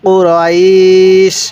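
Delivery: long held vowel closing on "sh"; stretch, then stop on sh; waqf stop ending. Shown as a demonstration. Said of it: "ওয়াকফ করলে কীভাবে শিখব?".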